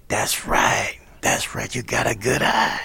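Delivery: gruff, breath-only rasp; imitated husky jazzman, approving. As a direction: rasp approval through a hoarse half-whisper. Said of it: "I remember him saying something like".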